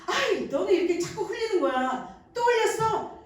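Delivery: a mother scolding a child for spilling food, mock. Shown as a demonstration. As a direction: chide the messy toddler, hammed-up. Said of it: "이렇게 책망하면요".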